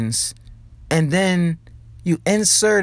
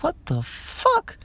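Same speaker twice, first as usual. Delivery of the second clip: high, slow, drawn-out what the fuck; shocked disbelief; 4 dB quieter, slower than usual. Retold or imitated. Reacting to legal abortion news, high slow shock. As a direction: stretch it out in stunned disbelief. I'm like.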